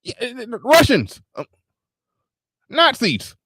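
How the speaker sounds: high, halting mock rant; imitating RBR. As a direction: fumble for words in a squeaky voice. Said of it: "I'm like".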